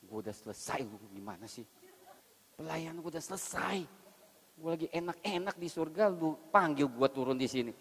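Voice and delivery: a touch of an annoyed wife; mildly angry wife. Acted. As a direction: grumble like a wife dragged back from heaven. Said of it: "istrinya marah".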